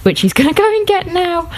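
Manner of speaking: ordinary delivery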